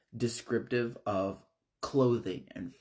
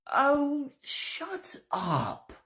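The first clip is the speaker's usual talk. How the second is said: very high wailing outburst; hammed-up tearful screech, starting loud; mock crying; much slower than usual. Evie drowning out Brandy from the stairs, loud fake-crying wail. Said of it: "crying louder to drown out the noise of Brandy".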